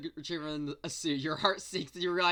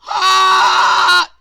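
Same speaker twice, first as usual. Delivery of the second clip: startled shout; 23 dB louder than usual. Real, not acted. Internet cuts out mid-sentence.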